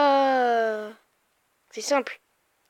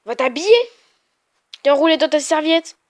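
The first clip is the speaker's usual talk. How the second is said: loud, annoyed order; shouting; 3 semitones higher, 5 dB louder than usual. Real, not acted. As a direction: chase her out, loud. Yelling sister out of room. (crier pour la chasser)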